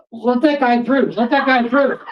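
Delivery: high, urgent call over a crowd. Retold or imitated. Singer on stage tells security to clear the way, high calling out. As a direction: order it from the stage, pitched high.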